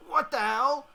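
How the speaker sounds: loud, high acted alarm; startled high outburst; scared surprise. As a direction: cry out in startled disbelief. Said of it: "Seth gets scared a little".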